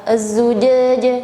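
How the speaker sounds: az-zujajah slow and carefully spaced; az-zujajah spelled out slowly; over enunciating. Shown as a demonstration. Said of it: "melafalkan kalimat az-zujajah".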